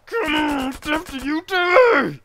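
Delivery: high, loud, slow war cry; hammed-up battle cry. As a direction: charge in roaring.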